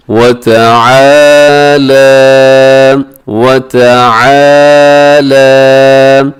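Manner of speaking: वतआला with आ and ला held long. Shown as a demonstration. Teacher stretching the standing zabar for students. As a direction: draw out वतआला. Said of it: "लाम को इसलिए लंबा करना है".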